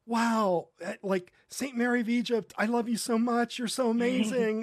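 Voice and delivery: adoring gush, acted out for a friend; imitated gushing. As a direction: play someone starstruck before icons. Host mimics her gushing at icons.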